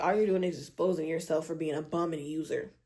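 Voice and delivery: ordinary delivery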